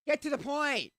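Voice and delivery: loud frustrated yell; shouting. Real, not acted. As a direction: shout it out, exasperated. Shouting at someone off-mic.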